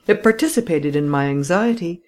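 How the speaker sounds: ordinary delivery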